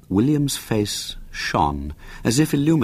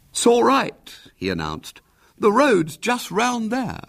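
lightly voiced boy, mock surprise; feigned delight; slower than usual. A narrator's reading. William faking delight to his aunt.

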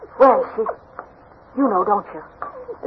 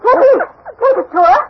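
shouting; 11 semitones higher than usual. Acted. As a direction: yell for the dog. Calling the dog.

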